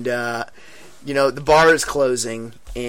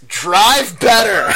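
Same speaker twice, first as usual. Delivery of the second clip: put-on slow, louder drunken order; a blunt command from the back seat; drunk grumble; 6 semitones higher, 5 dB louder, much slower than usual. Drunk friend scolding driver.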